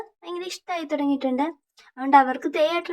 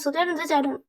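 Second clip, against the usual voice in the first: plain reading voice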